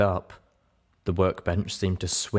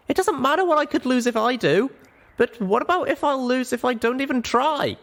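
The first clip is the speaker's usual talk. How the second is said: put-on high, impassioned young pony voice; a small mare arguing with all her heart; young Littlepip pleading. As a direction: plead hard for the prisoner, voice pitched up. Littlepip continues her plea.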